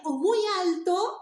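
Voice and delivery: high, loud demonstration. Shown as a demonstration. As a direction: raise the volume to show "alto". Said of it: "El volumen es lo que gradúa".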